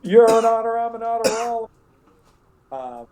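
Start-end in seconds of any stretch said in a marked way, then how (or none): 0.0-1.7 much higher, louder, playful chant; high sing-song joking chant; silly singsong. Shown as a demonstration. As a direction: make it a goofy little jingle. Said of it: "we always called it I'm an otter".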